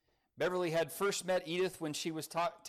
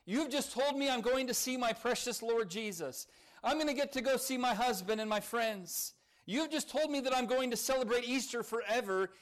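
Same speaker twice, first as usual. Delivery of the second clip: higher, rising, triumphant joy; joyful; 7 semitones higher than usual. A narrator's reading. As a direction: let her faith lift the voice, lightly voiced. Edith's joyful rebuke of doctor.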